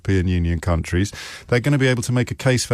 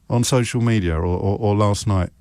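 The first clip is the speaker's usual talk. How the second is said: ordinary talk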